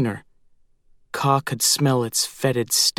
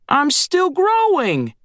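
high, slow, reedy crow squawk; Screech reedy squawk; 3 dB louder, slower than usual. A narrator's reading. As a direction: squawk it like a tiny hungry crow. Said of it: "His voice was a reedy squawk".

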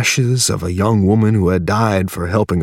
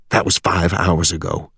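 plain reading voice

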